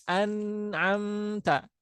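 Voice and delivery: very slow an'amta, nun drawn out; slow recitation. Shown as a demonstration. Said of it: "lin, nun". (an'amta dibaca sangat pelan, nun dipanjangkan)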